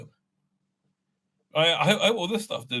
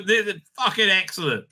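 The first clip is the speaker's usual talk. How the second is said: ordinary talk, nothing marked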